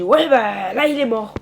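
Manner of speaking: very loud bitter comeback; angry retort. Acted. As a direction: throw the death back at them loudly. Farmer snaps back about dead son.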